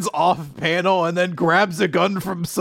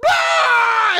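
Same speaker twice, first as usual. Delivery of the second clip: sudden high yelp of panic; a short startled villain shriek; panicked scream; 5 dB louder than usual. Retold or imitated. Friend acting out Ratri cornered, panicked scream. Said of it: "and he's like".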